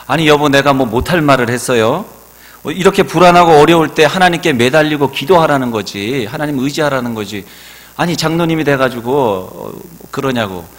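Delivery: mock exasperation, as if she can't believe it; flabbergasted retort. Wife retorting to her husband, exasperated and incredulous. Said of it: "황당해가지고".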